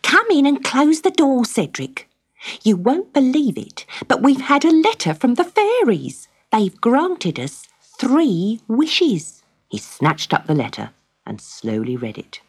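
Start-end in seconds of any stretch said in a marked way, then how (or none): 0.0-9.4 high, eager wife's voice bubbling with news; a thrilled wife who can't wait to tell; excited wife. Acted. Wife Magda telling husband about the fairy letter, high and thrilled.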